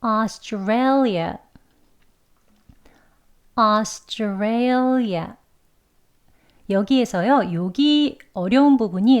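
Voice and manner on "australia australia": Australia said very slowly; Australia drawn out syllable by syllable; slow careful Australia; slow demo. Shown as a demonstration. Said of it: "천천히 한번 해볼게요".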